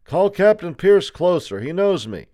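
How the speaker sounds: high, loud pleading shout; crying out. A narrator's reading. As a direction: call out urgently for someone who knows you. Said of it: "he cried".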